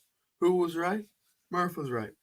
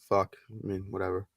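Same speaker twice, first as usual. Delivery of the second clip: ordinary talk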